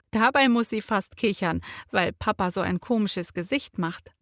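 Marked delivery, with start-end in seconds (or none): none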